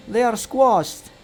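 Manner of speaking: high, loud and slow; drawn-out, high-pitched objection; squabbling retort. A narrator's reading. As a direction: snap back loudly, stretching the words. Berry squabble continues.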